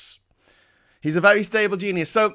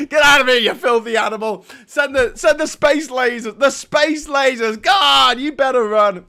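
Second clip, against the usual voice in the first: put-on loud, high New York accent shouting; hammed-up New York yell; 5 semitones higher, 7 dB louder than usual. Heckler chasing Greene out of New York, New York accent shout. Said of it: "literally from Home Alone".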